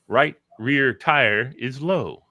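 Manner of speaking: slow, measured car announcement; imitated talking car. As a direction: recite it like an automated car warning. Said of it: "It says things like".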